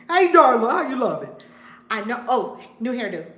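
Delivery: loud, high, excited hello; calling out a greeting. Real, not acted. Host greeting a viewer who just joined, calling out. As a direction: holler a delighted hello.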